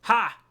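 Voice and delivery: single loud bark of a laugh; old woman's loud mocking bark. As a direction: bark one harsh laugh. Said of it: "barked out a single laugh".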